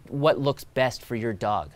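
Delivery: normal talking voice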